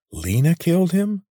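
high, startled ask; shocked question. A narrator's reading. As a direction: blurt it out in disbelief. Corvin asks in shock.

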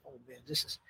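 very soft, low aside; acted awe. Musician looking over at a Beatle onstage, hushed awed aside. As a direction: murmur it to yourself in mock disbelief. Acts out awed private reaction.

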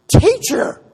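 a sudden, very high, very loud cry of recognition; yelling out. Acted. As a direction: shout it out the moment you recognize him. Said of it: "she yells out".

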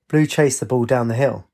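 usual speaking voice

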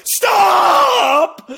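high, loud mock shout of stop; a pretend desperate yell. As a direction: shout it at the song in your head. Yells at song.

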